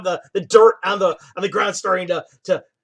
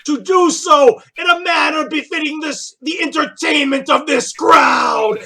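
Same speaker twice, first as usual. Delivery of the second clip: loud, high showman's proclamation; arena announcer; 6 semitones higher, 5 dB louder than usual. Acted. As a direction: rouse the crowd for blood.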